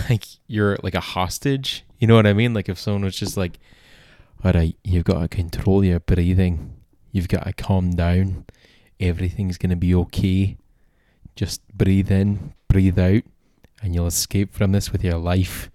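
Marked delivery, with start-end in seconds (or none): 4.4-15.7 mock Scottish accent. Host imagining a Scottish meditation guide, mock Scottish accent. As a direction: talk them through breathing in a put-on Scottish accent. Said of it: "even the Scottish accent".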